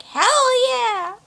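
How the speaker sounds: high, swooping whoop; high-pitched gleeful holler; excited cheer. Retold or imitated. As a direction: cheer it out like a thrilled fan. Retold own excited cheer.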